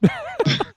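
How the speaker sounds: quick high-pitched laugh. Real, not acted. Laughing at hitchhiking joke.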